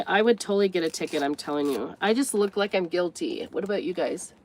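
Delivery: normal talking voice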